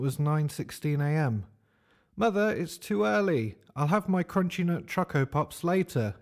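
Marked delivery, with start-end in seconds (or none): 2.2-6.1 higher mock shout at mum; mild shout. A narrator's reading. Johnny calling his mother through the door, mild mock shout. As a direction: call out to mum, a bit hammed-up. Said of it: "shouted Johnny".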